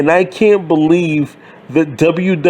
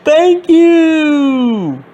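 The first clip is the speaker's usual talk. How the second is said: mock wrestler yell; 7 semitones higher than usual. Fan mocking a grown man yelling in a one-piece suit. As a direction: belt it out like a wrestler playing to fans.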